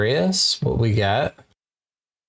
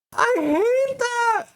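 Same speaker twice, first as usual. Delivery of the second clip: high, slow fake sob; mock crying; much slower than usual. Streamer fake-sobbing over a viewer's Sims breakup. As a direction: pretend to cry it out, hammed-up.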